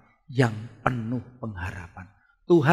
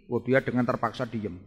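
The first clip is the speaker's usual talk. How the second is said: ordinary delivery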